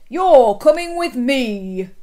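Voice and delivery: loud, slow heroic command; booming superhero arrest; shout. A narrator's reading. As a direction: order the villain along firmly. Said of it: "he shouted".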